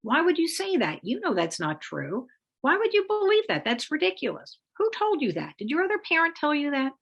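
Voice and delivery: mock a hurt, defensive mom or dad; hammed-up defensive protest; defensive parent. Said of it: "the targeted parent is likely to respond".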